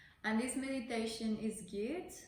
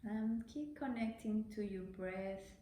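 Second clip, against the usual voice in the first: unhurried meditation voice with long pauses; guided meditation; 6 dB quieter than usual. Real, not acted. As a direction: gently guide the breath, leaving space after each phrase.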